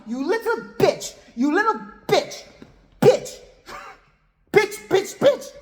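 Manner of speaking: high-pitched mock-furious tirade; pissed-off yelling. Regina tearing into Kim, high imitated angry outburst. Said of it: "Regina George gets pissed".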